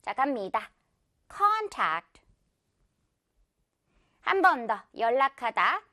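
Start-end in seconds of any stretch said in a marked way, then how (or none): 1.3-2.2 contact with a high, long CON, falling on tact; stress on CON. Shown as a demonstration. Teacher says it for repetition, front-stressed contact. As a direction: hit con first in contact. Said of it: "앞부분의 강세".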